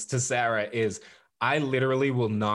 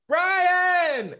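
high, loud, stretched-out shout; yelled name; 6 dB louder than usual. Retold or imitated. Stranger calling him in the parking lot, loud yell. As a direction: holler the name across a lot. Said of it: "someone just out of nowhere yells".

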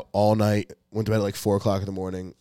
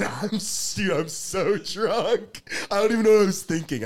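high laughing squeal; helplessly laughing through the words, pitched way up; cracking up; 11 semitones higher than usual. Real, not acted. Friend telling podcast mates how drunk he was, cracking up.

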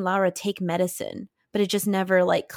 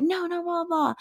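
high put-on voice brushing it all off; mocking dismissal; 10 semitones higher than usual. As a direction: toss it away in a silly high voice. Mother dismissing warnings, mocked.

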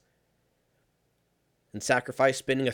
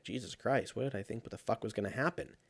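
muted exasperation; 9 dB quieter than usual. Retold or imitated. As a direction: grumble quietly at your own foolishness. Retold self-reproach after overpacking.

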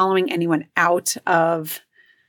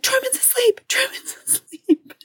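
put-on much higher, frantic child's cry; high panicky kid voice. Mom retelling son's panic, high frantic voice. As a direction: mimic the scared son, voice up high. Son panicking about sleeping brother.

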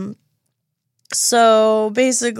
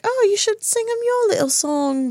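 Daphne's voice; 13 semitones higher than usual. Retold or imitated. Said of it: "Daphne's like".

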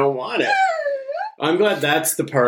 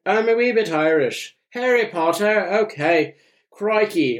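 a higher, hammed-up Irish accent drifting into Australian; mock Irish accent; 8 semitones higher than usual. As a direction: play a bad Irish accent that slips into Australian. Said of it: "Wait, that's Australian".

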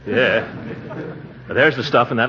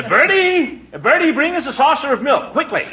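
calling out; 14 semitones higher than usual. Acted. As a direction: shout for Bertie down the hall. Calling out to servant.

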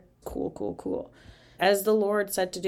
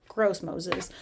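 usual speaking voice

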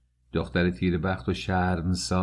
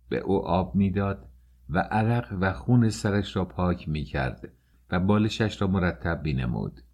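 plain reading voice

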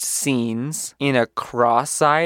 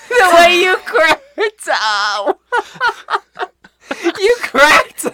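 high, loud words squeezed through laughter; laughing too hard to talk straight; helpless laughing; 4 dB louder, slower than usual. Real, not acted. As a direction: crack up while teasing a friend. Teasing co-host while laughing hard.

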